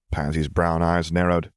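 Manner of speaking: usual speaking voice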